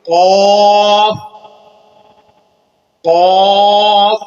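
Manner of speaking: قاف as a heavy uvular q; heavy qaf. Shown as a demonstration. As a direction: push قاف from the back of the throat. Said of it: "حلق سے تھوڑا سا زور لگا کر".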